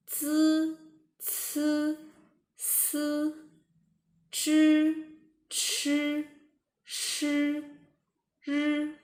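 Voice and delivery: "zi, ci, si, zhi, chi, shi, ri" with a buzzed uh-like vowel; high, level "zi, ci, si, zhi, chi, shi, ri", never ee; U, not E. Shown as a demonstration. Teacher reading the other consonants, "zi, ci, si, zhi, chi, shi, ri" with U. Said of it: "we don't pronounce it as E, but as U".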